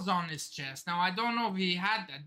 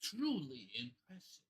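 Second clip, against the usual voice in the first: very soft, low, put-on emo voice; half-joking emo delivery, low and quiet; mock emo accent; 9 semitones lower, 12 dB quieter than usual. Shown as a demonstration. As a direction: play it emo, hushed and low. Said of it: "try to do this in emo accent".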